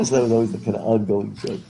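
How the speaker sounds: ordinary talk, nothing marked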